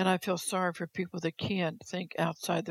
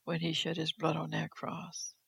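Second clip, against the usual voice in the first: normal talking voice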